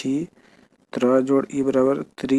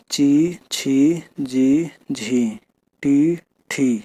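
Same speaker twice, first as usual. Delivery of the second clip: each syllable drawn out slowly on its own; slow syllable reading; much slower than usual. Shown as a demonstration. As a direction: read the syllables one by one slowly for the pupils. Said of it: "एक बार ऐसे भी पढ़ लेना". (धीरे-धीरे एक-एक अक्षर पढ़ना)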